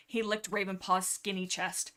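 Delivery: usual speaking voice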